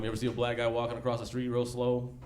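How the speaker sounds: ordinary talk, nothing marked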